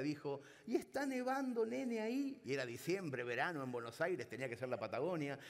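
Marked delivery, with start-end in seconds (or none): 0.6-2.4 high, imitating an old Argentine mother; old mother's voice. Old mother calling her son on a bad line, high imitating voice. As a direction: put on the doting old mother's pitch.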